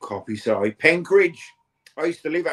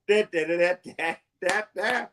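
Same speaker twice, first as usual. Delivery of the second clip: sung fanfare; 5 semitones higher than usual. Pretend. Drum fanfare after joke.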